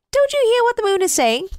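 high, quick, eager snowman. Acted. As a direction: urge the boy on. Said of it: "said the snowman".

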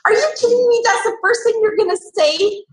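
imitating her own stunned disbelief; high mock outrage at an unromantic first word; incredulous. Wife reacts to husband's words.

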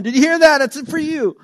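high, loud frantic yell across the house; loud panicked call to the family; frantic shout. Retold or imitated. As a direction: yell it like the phone is an emergency. Frantic household rushing to the phone.

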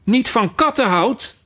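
high, indignant mouse outcry; worked-up mouse, pitch jumping up; shrill, agitated. Acted. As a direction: echo it back as a shrill, offended question. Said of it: "schreeuwde de muis met schrille, opgewonden stem".

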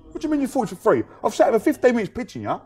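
high-pitched mock outrage, lightly voiced; mock indignant seller. Street seller scolding tourists, mock indignant. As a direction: play the indignant seller who wasted fifteen minutes.

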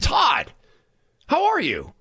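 put-on high-pitched greeting to an old acquaintance; delighted recognition. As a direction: imitate a surprised, high-pitched hello. Acted greeting from old acquaintance Drew.

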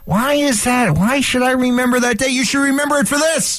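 high, slow teasing host build-up; dramatic radio tease. Acted. Host teasing listeners about the date, slow and high. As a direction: draw out each question like a countdown.

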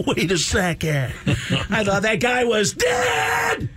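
high, slow, yelling comic voice; screeching stand-up rant, drawn out; Sam Kinison. Retold or imitated. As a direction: wind up into a wild screaming bit. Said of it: "a Sam Kinison impression".

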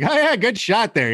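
high mock Norm praise; Norm Macdonald voice. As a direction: tease a pool shot in Norm's voice. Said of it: "You'd be like".

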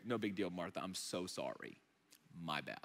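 lightly mocked sheepish apology, low and soft; imagined Jesus. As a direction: trail down into a quiet apology. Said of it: "She's expecting Jesus to say".

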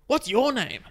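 high, loud, pushy demand; Adrian, crazy energy. Retold or imitated. Crazed customer demanding cafe guy's name, crazy energy. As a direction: imitate a crazed customer firing the question back. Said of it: "same kind of crazy energy".

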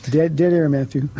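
ordinary talk, nothing marked

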